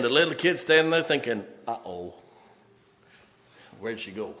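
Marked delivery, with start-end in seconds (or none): 1.7-4.3 put-on hushed worried child. Lost child's inner voice in story.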